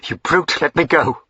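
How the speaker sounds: high, loud outraged cry to be let go; desperate cry. Acted. Diana struggles against the duke, crying out in outrage. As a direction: fight him off with your voice.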